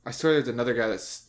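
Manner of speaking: ordinary talk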